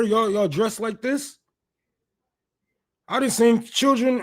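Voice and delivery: high, loud disbelief at the outfit; roasting the man's clothes, outraged and high; incredulous roast. Real, not acted. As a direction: clown his clothes in mock outrage.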